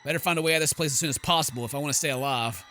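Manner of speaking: usual speaking voice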